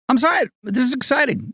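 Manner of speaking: high-pitched, giddy podcast opener; excited. Real, not acted. Host kicking off a podcast episode, excited. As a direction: let the excitement send your voice way up. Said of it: "I'm so excited".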